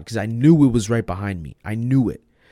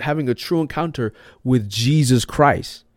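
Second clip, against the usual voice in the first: normal talking voice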